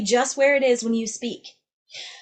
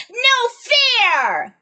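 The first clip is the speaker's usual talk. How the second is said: high, loud mock tantrum yell; scrunched toddler yell; 14 semitones higher, 8 dB louder than usual. Shown as a demonstration. Teacher plays toddler whose toy was taken, belted yell. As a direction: yell like a mad three-year-old. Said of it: "We're yelling at them".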